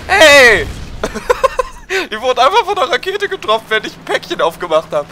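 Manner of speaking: high, laughing through the words. Real, not acted. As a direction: laugh while telling it. Teammate blown up by rocket.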